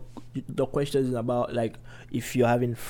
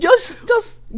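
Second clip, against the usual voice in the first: squealing; 7 dB louder than usual. Real, not acted. Squealed interjection.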